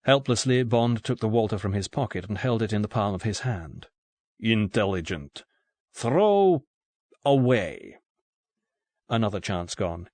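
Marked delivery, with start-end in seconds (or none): 4.4-8.0 put-on Russian-accented approval rising into a drawn-out command; thick Russian accent. A narrator's reading. Russian gunman makes Bond discard his pistol, thick Russian accent. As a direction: lift and stretch the order, heavily Russian-accented. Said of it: "in a thick Russian accent".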